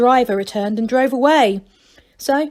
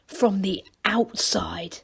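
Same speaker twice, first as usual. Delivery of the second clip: awed whisper; 2 semitones lower, 6 dB quieter, slower than usual. Acted. Angelo awed secret.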